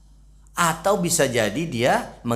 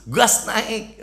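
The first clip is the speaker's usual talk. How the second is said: high-pitched mock outcry; hammed-up protest; mock complaining yell; 11 semitones higher, 3 dB louder than usual. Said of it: "Besok dua-duanya teriak".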